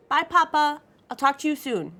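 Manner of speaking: high, bright daughter's farewell; fond goodbye. A narrator's reading. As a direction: say goodbye sweetly, pitched well up. Daughter says goodbye to father.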